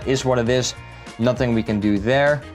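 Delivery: ordinary talk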